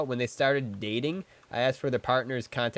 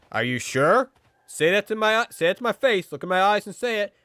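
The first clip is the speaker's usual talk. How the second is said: louder, higher, pressing demand; movie dad; 8 semitones higher, 5 dB louder than usual. Pretend. Movie dad confronts sister's date, loud insistent demand. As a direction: play a movie dad challenging the suitor. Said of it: "she's the stereotypical movie dad".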